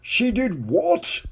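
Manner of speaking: high, slow, distraught king voice; a wailing king, high and slow; king crying out. A narrator's reading. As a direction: cry it out like a despairing king. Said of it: "cried the king".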